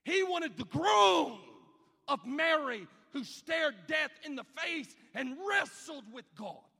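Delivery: loud, high, impassioned preaching shout; full-force preaching. Acted. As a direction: climb to a roaring pulpit peak.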